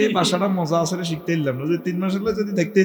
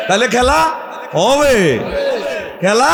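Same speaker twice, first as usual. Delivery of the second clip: rallying slogan; 5 semitones higher, slower than usual. Real, not acted. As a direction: throw it out for the crowd to finish. Slogan with crowd answering. (উঁচু গলায় স্লোগানের হাঁক)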